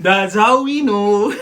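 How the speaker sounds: slow, loud laughing punchline. Real, not acted. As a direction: land it slowly while laughing.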